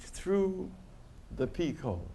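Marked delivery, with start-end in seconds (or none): none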